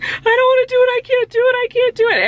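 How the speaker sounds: high, loud wailing cry of panic; reliving the breakdown in a high, loud voice; retold sobbing. After the birthing class, panicking to Eric: high loud wail. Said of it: "I literally was sobbing".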